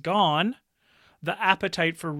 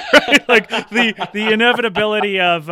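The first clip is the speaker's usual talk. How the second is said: laughing; 6 semitones higher, 11 dB louder than usual. Real, not acted. Laughing at own loophole joke.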